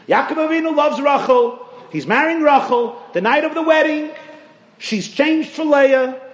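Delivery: high, loud storyteller's build-up; preaching at full force. Acted. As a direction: sweep the crowd along, rising and loud.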